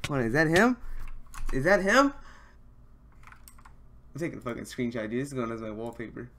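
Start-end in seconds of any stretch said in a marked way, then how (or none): 0.1-0.8 higher and louder, asking in disbelief; can't believe who just showed up; startled, eager question; excited disbelief. Real, not acted. Disbelief at spotting idol. 1.5-2.1 higher still, near a squeal of disbelief; asking again, now almost yelping; louder, higher repeat of the question; rising excitement. Real, not acted. Disbelief at spotting idol.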